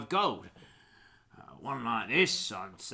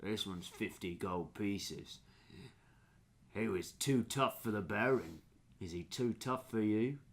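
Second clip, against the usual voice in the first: low, measured dog-seller; slightly lowered ranger character voice; lightly voiced challenging ranger; 4 semitones lower, 6 dB quieter than usual. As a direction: quietly needle the buyer. GM plays ranger bargaining.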